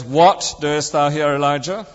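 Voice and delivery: "What" drawn out and punched, the rest following; stress on WHAT. Retold or imitated. Friend mimicking a preacher's emphasis, stress on "What". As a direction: lean hard on the first word, "What". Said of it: "emphasizing each word in turn".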